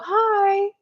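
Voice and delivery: very high, cooing hello; adults greeting a child. Acted. Adults turning to a little kid, high cooing greeting. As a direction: pitch it way up as if to an adorable child. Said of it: "all the adults turn and go".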